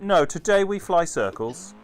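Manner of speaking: slow, loud, deadpan robot decree; mock stubborn computer. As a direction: announce it like a stubborn machine. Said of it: "where it just went".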